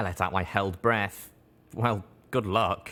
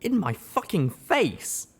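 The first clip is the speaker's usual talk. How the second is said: high, slow, louder disbelief; incredulous outrage; 11 semitones higher, 4 dB louder, slower than usual. Acted. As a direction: stretch it out in outraged shock. Outraged reply to being shot.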